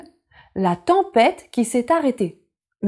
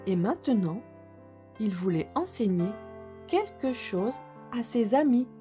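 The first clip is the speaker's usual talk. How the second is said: soft storytelling voice; 4 dB quieter than usual. A narrator's reading. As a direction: read gently to little children. Story narration.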